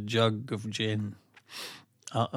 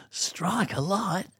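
slow, raised Australian drawl; Australian accent; 6 semitones higher, 3 dB quieter, much slower than usual. Acted. Host showing off his maligned accent, mock Australian. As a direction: stretch it out in a put-on Australian accent. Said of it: "abused for my Australian accent".

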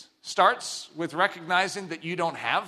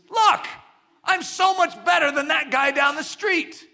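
raised, higher boastful retort; boastful husband; 7 semitones higher, 3 dB louder than usual. Retold or imitated. Husband to wife, a boastful raised retort. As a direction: brush off the wife, bragging loud. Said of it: "he says".

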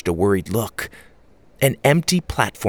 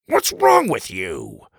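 exasperated grandpa; slower than usual. A narrator's reading. Grandfather wiping curry off his shirt, exasperated. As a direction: scold him slowly.

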